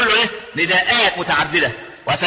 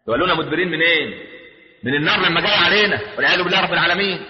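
usual speaking voice

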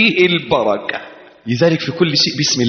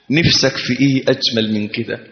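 ordinary talk

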